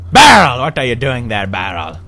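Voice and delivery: loud surprised call; shout. Real, not acted. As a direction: yell the name out. Calling out to a barrel.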